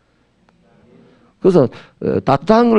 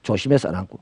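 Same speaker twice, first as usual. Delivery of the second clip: muttered annoyed complaint, dropping soft; a mild mock grumble; irritated grumble; 6 semitones lower, 5 dB quieter than usual. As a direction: grumble at the stranger who bumped you. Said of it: "옛날에는 그래요".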